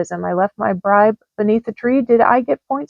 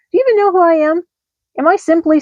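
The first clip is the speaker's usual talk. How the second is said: indignant outburst; 7 semitones higher, much faster than usual. Acted. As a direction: demand to be remembered, voice jumping up. Vixen's angry resignation letter.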